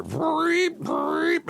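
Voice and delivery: high-pitched mimicked alarm whoop; imitated fire alarm. Husband imitating the alarm that went off every night, shrill mimicry. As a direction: vreep like a hotel fire alarm. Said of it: "you'd hear".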